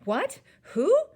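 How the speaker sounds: high, loud startled questions; two sharp jumps up in alarm; voice gone funny. A narrator's reading. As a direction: squawk it in shock. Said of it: "his voice goes all funny".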